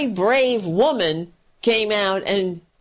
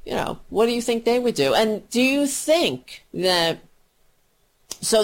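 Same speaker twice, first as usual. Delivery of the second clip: ordinary talk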